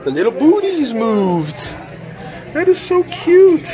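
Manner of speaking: high, slow, delighted gushing. Real, not acted. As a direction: coo over something adorable. Gushing over Minion craft.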